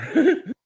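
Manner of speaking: a short laugh through burning heat; pained laugh. Real, not acted. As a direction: laugh it off, mouth on fire. Laughing after failing.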